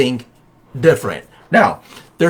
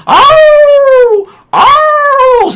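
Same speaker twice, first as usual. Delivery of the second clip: mock dog howling, much higher and louder; 7 dB louder than usual. Farm dog howling in the background of the sales pitch, mock howl. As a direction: yelp out a hammed-up howl like a dog in pain. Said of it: "the dog's howling in the background again".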